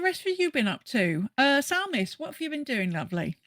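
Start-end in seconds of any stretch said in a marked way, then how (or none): none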